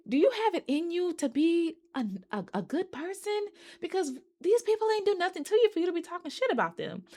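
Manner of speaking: high-pitched mock disbelief; indignant scolding. Scolding a character for trash talk.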